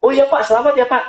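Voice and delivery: retelling a cheerful congratulation to a client; cheery congratulations. Retold or imitated. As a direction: lightly act out chirpy well-wishing. Coach congratulating the father.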